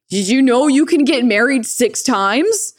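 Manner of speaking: put-on excited kid news. Kid excitedly telling mom.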